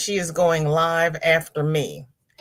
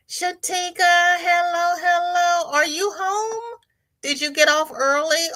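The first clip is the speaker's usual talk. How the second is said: singsong greeting; 12 semitones higher than usual. Real, not acted. Host greeting Shatika as she joins, high singsong. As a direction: sing out the welcome on a high note.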